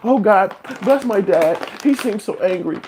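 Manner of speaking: very high-pitched mock child voice; whiny little kid. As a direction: plead in a tiny, high kid voice. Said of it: "you're a little kid".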